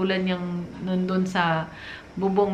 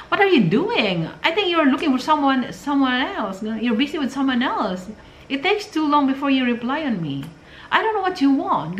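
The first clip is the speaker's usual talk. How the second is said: put-on angry demanding girlfriend; 5 semitones higher than usual. Demanding girlfriend accusing her foreign boyfriend over late replies, high angry rant. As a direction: play a jealous girlfriend nagging her boyfriend. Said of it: "parang galit na galit ka".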